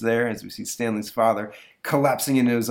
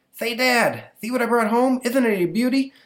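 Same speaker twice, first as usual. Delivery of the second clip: put-on much higher, louder kid voice with a lisp; boy with a lisp; 14 semitones higher, 5 dB louder than usual. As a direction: play a lisping little boy showing off his find. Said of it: "Stanley's got, yeah, a horrible lisp".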